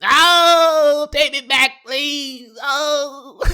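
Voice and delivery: high, hammed-up crying plea; begging his ex to take him back, high wailing moan. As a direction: moan it like a heartbroken fool.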